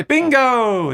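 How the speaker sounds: high, loud jubilant call; playful burst of triumph; mock bingo shout. Playing bingo caller with chat guesses.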